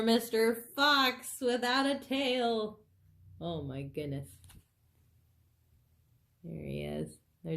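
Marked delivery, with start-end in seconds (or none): none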